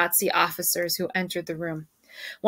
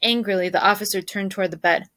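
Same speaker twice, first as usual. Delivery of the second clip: usual speaking voice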